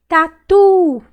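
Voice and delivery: "Tatu" with a loud, high, long TU; stretched stressed syllable. Shown as a demonstration. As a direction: call out "Tatu", holding TU. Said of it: "Qual que eu demorei mais falar".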